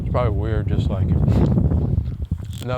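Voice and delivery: talking through a mouthful of apple; mouth full. Real, not acted. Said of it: "sorry, I'm eating with my mouth full".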